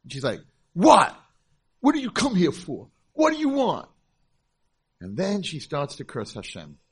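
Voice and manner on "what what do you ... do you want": high, loud, hostile demand; angry teen. Retold or imitated. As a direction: snap back like a furious teenager. Angry girl confronting him.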